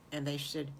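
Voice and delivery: usual speaking voice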